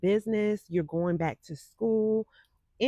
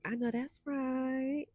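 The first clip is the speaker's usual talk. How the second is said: drawn-out sass; 5 semitones higher, 5 dB quieter, slower than usual. Shown as a demonstration. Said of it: "In my [name] voice".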